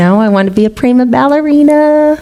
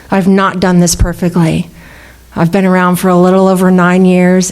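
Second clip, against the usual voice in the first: normal talking voice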